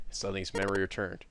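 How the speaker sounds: normal talking voice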